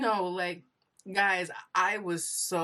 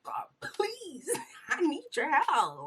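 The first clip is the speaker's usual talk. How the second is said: higher, desperate plea to God; pleading prayer; 11 semitones higher than usual. Retold or imitated. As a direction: beg God for help, half-laughing. Retold intensive prayer.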